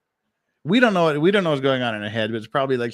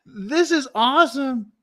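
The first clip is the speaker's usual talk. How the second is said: higher, delighted exclamation; real excitement, pitched up and slow; thrilled; 11 semitones higher, 3 dB louder than usual. Real, not acted. Vlogger watching the interrogation, thrilled and pitched up.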